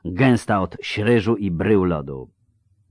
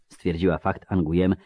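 ordinary delivery, nothing marked

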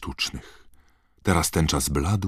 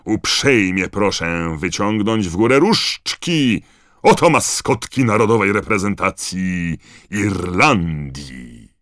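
loud, raised stadium announcement; booming announcer; 4 semitones higher, 6 dB louder than usual. A narrator's reading. Bagman presenting Ireland's mascots to the stadium, booming. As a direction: project grandly to a whole crowd. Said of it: "zagrzmiał głos Ludona Bagmana".

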